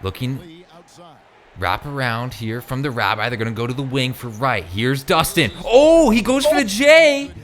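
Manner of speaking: esports caster calling the action; play-by-play. Acted. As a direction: call the game live, building on big plays. Live video game play-by-play.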